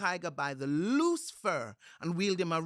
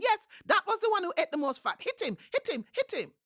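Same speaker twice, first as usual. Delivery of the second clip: much higher, loud egging on; high spider cheering; 7 dB louder than usual. A narrator's reading. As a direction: urge him on gleefully, high-pitched. Anansi egging Tiger on.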